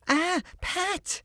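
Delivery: very high, delighted call; light up at finding him; joyful cry. A narrator's reading. Little girl spotting her friend on the road, crying out joyfully. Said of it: "cried she joyfully".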